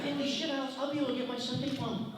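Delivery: high, excited mock wonder; retold astonishment. Writer learning the book club's size, acted amazement. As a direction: blurt it with acted glee.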